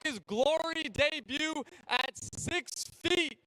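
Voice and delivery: booming fight introductions; ring announcer. Acted. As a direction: announce them to the arena.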